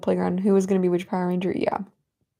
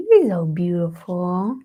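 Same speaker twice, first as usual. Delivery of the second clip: slow, louder cooing "You're so beautiful" in pet-talk voice; 2 semitones lower, 3 dB louder, slower than usual. Real, not acted. Cooing to her cat.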